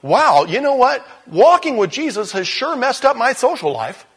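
high and loud with mock dismay; dismayed young believer. A young believer laments a ruined social life, high and rueful. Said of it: "suddenly you're going".

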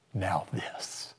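soft whispered "Now this."; hushed reveal. Pretend. God showing believers heaven, soft whisper. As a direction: unveil it like a secret. God unveiling the next glory.

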